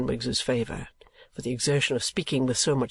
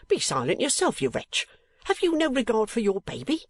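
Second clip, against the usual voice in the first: high, indignant scolding; scolding nurse; 9 semitones higher than usual. A narrator's reading. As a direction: scold him sharply.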